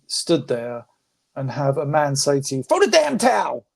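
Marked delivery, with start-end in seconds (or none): 2.6-3.6 much higher, strained coach yell; Duke impression. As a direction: bark it like a frantic cornerman. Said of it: "That was Duke".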